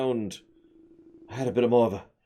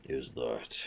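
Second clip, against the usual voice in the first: ordinary talk, nothing marked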